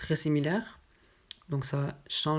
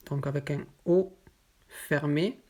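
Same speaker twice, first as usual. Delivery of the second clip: usual speaking voice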